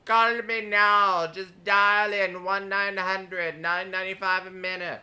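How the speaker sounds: slow, loud psychic hotline voice; loud, drawn-out TV psychic pitch; Miss Cleo. Retold or imitated. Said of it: "we had Miss Cleo".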